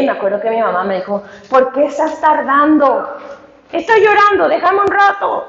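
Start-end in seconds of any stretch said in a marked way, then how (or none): 1.5-3.1 higher-pitched calling mother; imitated impatient mother. Mother asking daughter why she is taking long, imitated impatience. 3.7-5.4 high-pitched mock wail; retold tearful protest. Crying daughter answering her mother, hammed-up tearful protest. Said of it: "Y lloré".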